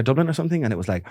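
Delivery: normal talking voice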